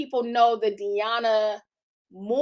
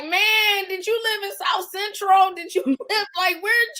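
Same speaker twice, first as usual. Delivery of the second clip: higher, amazed onlooker asking with a laugh; mock amazed onlooker; 9 semitones higher than usual. Guests gawking at her past, mock amazed and pitched up. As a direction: play the stunned friend, hammed up. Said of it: "you be like".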